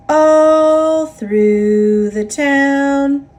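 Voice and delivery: plain reading voice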